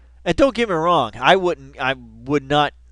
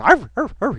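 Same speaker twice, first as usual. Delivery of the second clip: higher, louder yappy little barks; chihuahua barks; 9 semitones higher, 5 dB louder than usual. Retold or imitated. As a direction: yap like a tiny dog at lights. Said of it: "You're like a chihuahua".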